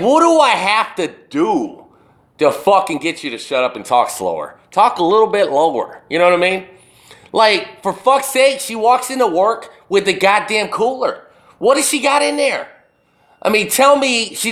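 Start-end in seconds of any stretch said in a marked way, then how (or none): none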